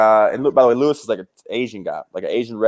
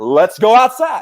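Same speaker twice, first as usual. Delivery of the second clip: slow, raised, mocking echo; incredulous echo; 9 semitones higher, 4 dB louder, slower than usual. Teller echoing the bar guy's dare, slow disbelief. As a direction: repeat it back slowly in disbelief. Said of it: "I'm like".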